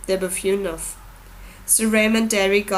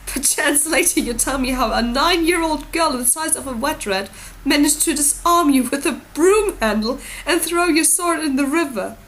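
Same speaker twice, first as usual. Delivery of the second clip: mocking lord; 7 semitones higher, 4 dB louder than usual. A narrator's reading. Renly mocking the prince.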